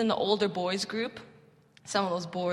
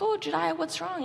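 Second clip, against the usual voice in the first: put-on much higher, gentle worried voice to a sad kid; concerned; 9 semitones higher than usual. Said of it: "I was like".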